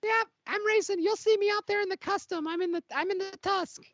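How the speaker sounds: bright high voice of an eager little racer; high-pitched racer voice. Acted. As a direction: play a jittery alien pod racer, high and quick. Racer character voice.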